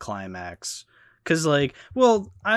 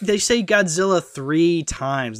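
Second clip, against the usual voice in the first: slow, louder, leaning on say; exasperated emphasis; 3 semitones higher, 5 dB louder, slower than usual. Real, not acted. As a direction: spell out the absurdity word by word. Mocking film's repetition, exasperated.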